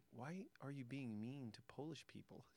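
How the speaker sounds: softly and slowly take Dad to task; quiet reproachful child. Retold or imitated. Dad retells young daughter's question, quiet reproach.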